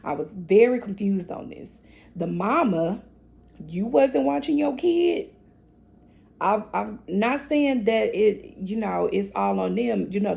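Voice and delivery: usual speaking voice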